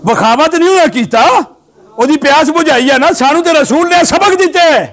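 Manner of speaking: high, ringing sermon point; impassioned preaching. Real, not acted. Preacher tells congregation what the Prophet taught, impassioned preaching. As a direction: drive the lesson home with pitch climbing. Preacher drives home the lesson.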